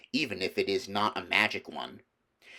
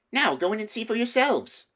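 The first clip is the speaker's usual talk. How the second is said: put-on higher, eager girl's call; crying out; 13 semitones higher, 4 dB louder than usual. A narrator's reading. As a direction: throw the door open and urge them in. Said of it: "and cried".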